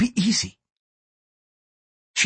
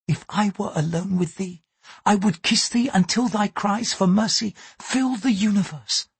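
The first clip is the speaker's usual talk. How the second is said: Mariko, gentle Japanese lady; 9 semitones higher than usual. A narrator's reading. Mariko warns her lover why he is guarded, higher, earnest. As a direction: speak up in a high, earnest woman's register.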